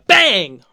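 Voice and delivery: loud, punchy mock gunshot "bang"; imitated gunshot. Recapping the shootout for viewers, a loud mock gunshot. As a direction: blurt it out like a pistol firing. Ellie shoots man in retelling.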